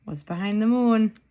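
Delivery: ordinary delivery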